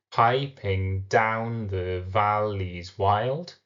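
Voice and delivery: very slow PI-ping DOWN the VAL-leys WILD; "piping down the valleys wild" drawn out, every other syllable stressed; stressed-unstressed beat. Shown as a demonstration. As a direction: chant out the alternating beats of "piping down the valleys wild". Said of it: "follows the stressed, unstressed".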